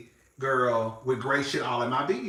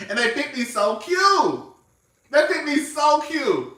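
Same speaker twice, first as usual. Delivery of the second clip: higher and louder, slow, through a laugh; laughing delight; 10 semitones higher, 6 dB louder than usual. Real, not acted. Telling viewers the coats fit, laughing, high and slow. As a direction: gush about the fit while laughing.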